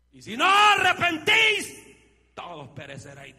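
slow, high roar of scripture; thundering quote. Acted. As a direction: proclaim Christ's warning with a roar. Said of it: "dijo Cristo". (cita de Cristo gritada)

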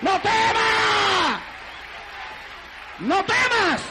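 building to high, drawn-out shouts; preacher voicing an urgent message from God; shouted prophecy. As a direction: deliver it as a fervent prophetic cry. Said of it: "sale corriendo en profecía y".

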